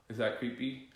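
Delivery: sharp pretend gasp of panic, then a light question; mock panicked gasp. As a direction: fake a panicky gasp to show the breath. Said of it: "I'm just pretending like I'm panicking".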